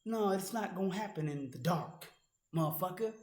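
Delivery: normal talking voice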